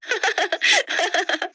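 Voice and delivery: bright high burst of laughter. Acted. Mother-in-law teases daughter-in-law, everyone laughing. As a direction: laugh along at the teasing.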